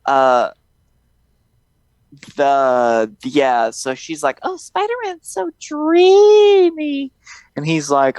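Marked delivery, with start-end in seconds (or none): 4.4-7.1 slow, very high girly gushing imitation; mock lovestruck swoon. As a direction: gush dreamily in a high, drawn-out fake voice. Voices swooning Mary Jane.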